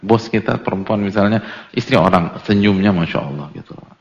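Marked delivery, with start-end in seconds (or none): none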